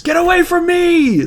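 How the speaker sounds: high, loud shriek of Get away from me; shrieked line. Acted. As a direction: yell it high and loud in panic. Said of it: "the big panel that says".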